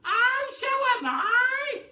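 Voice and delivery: very high, loud, slow cry; crying Mrs Twit. A narrator's reading. Missus Twit crying out that she shrinks too, squealing. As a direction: squeal it out in horror. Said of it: "cried Missus Twit".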